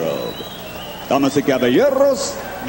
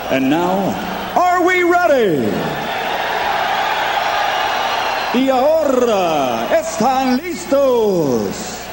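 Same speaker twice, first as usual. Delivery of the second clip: ring announcer hype; much slower than usual. Acted. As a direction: pump up the arena crowd. Ring announcer hypes crowd.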